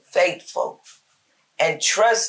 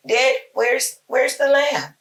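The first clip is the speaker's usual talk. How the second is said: put-on higher boyish voice asking his dad; a son's innocent question, pitched up; young Isaac asking; 7 semitones higher than usual. Son Isaac asks his father Abraham, higher boyish voice. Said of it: "Isaac asked his father".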